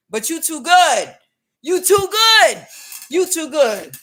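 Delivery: high, loud, hammed-up West African jeer; mock West African accent. Jeering at an Empress who refuses him, mock West African accent.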